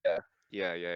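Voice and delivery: ordinary talk, nothing marked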